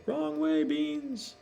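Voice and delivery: put-on high little-boy scold; kid's voice. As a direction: play the little brother scolding the dog. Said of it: "And he's saying".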